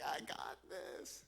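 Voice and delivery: slow, breathy, cocky under-the-breath boast; cocky Charlie Brown. Retold or imitated. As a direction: savour the imagined win. Charlie Brown's cocky race moment.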